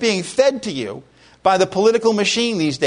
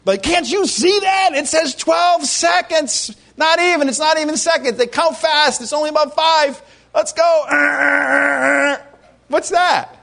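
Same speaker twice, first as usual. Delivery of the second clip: hammed-up fuming behind the wheel, pitched way up; impatient driver's outburst; 8 semitones higher, slower than usual. Stuck behind a slow driver at a crosswalk, mock shouting in exasperation.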